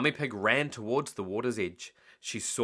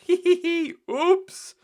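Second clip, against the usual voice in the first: put-on high, loud child giggle and oops; giggly child; 8 dB louder, slower than usual. Children caught making a mess, high giggling. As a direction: giggle like a guilty piglet.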